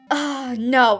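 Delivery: ordinary delivery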